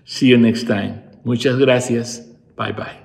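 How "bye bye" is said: ordinary delivery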